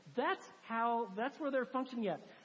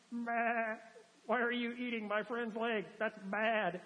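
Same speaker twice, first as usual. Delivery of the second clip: mock sheep bleat; slower than usual. Voicing an indifferent sheep.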